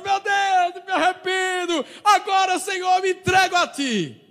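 loud, high pleading cry to the Lord; a convert cries out loud to God; crying prayer. Retold or imitated. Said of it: "Aí ele começa chorando".

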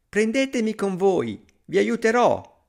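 high, eager call for attention. A narrator's reading. Tiny hero calls to thieves.

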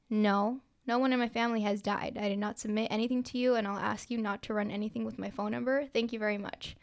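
ordinary delivery

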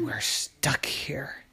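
slow, hushed dread; frightened whisper. Acted. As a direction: whisper it from hiding. Hiding in the attic.

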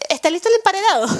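high, loud mock child voice; hammed-up little kid, high and loud; imitated dubbed-kid voice. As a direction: pipe it up like a kid. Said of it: "Cuando los niños dicen que".

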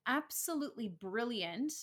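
usual speaking voice

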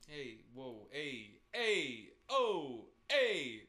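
escalating mock Italian exclamations; hammed-up Italian hollering. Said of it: "They're Italians".

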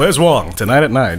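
low promo voice, joking; deadpan pretend broadcast teaser; mock TV announcer. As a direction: announce it like a programme trailer. Joke TV programme promo.

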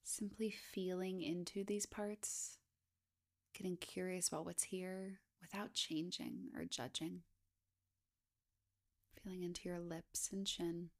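hushed, even body-scan voice with long pauses; guided meditation. Acted. Guide inviting listeners to scan down the body, guided meditation. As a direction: gently lead a meditation, unhurried, leaving space between phrases.

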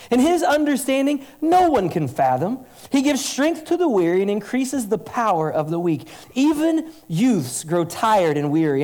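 high, loud scripture proclamation, ringing out; preaching at full force. Acted. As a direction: declaim the prophet's words to the whole church. Reads Isaiah forty aloud.

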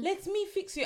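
high, cooing French lady voice; French accent. Retold or imitated. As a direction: fuss over her in French accent. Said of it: "pardon my French accent".

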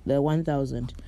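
ordinary talk, nothing marked

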